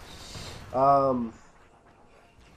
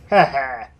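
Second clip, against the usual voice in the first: mock laugh; 8 dB louder than usual. Mock triumphant laugh after joke.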